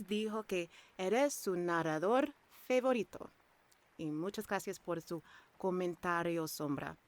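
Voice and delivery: fluent Spanish read with a native Spanish accent; Spanish with a confident native-like accent. Shown as a demonstration. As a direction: switch into Spanish and roll it like a native speaker. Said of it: "I just have a good Spanish accent".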